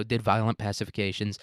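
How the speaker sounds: usual speaking voice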